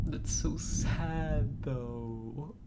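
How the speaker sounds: slow, whispered, choked up; slow whispered sadness; sad whisper. Real, not acted. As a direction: whisper it as if fighting tears. Moved by sad game ending.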